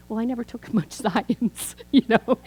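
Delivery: amused, laughing through the words; self-mocking giggle as you admit it. Real, not acted. Laughing at her own ignorance.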